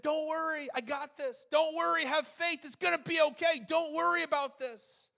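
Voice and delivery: high, loud acted encouragement; emphatic preacher quoting Jesus at full voice; insistent reassurance. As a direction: reassure forcefully, pitched well up.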